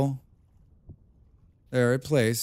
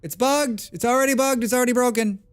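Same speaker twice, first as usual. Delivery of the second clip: high, rising alarm at a bug; panicked it's broken; 10 semitones higher than usual. Retold or imitated. Gamer freaking out that the mount system broke, high alarm. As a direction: squeal that the game is already broken. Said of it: "I was like".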